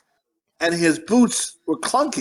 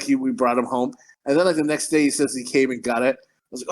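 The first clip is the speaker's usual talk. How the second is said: ordinary talk, nothing marked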